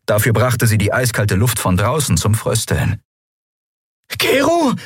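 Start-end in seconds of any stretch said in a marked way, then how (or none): 4.2-4.7 high strained croak. Acted. Endriel choking on smoke calls her pilot, high croak. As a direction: croak it out urgently. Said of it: "krächzte sie".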